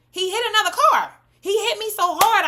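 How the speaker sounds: high, loud, emphatic retelling; worked-up exclaiming. Real, not acted. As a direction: exclaim it in disbelief. Worked up recounting the hit.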